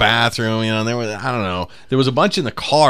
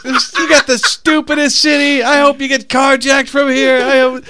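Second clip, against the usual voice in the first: much higher, loud mock tirade; ranting comic; 6 dB louder than usual. Burr cursing Philadelphia, high and loud. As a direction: scream it at the city. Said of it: "He's like".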